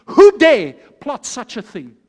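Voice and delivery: loud, slow, thundering demand; outraged king. Acted. King demands the plotter's name, loud outrage. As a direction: hit the words like a fist on the table. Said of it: "he hits the table and he says".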